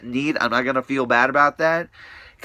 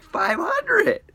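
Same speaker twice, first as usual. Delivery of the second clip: drawn out, pitched way up; outraged disbelief. Real, not acted. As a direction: stretch the price high in outrage. Outraged price, high, slow.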